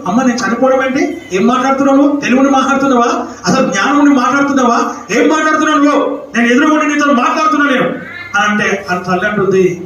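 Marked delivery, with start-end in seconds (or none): 0.1-8.1 higher and rising into a shout; a son protesting, voice climbing; indignant son. Acted. Son snaps back at his mother, higher, building to a shout.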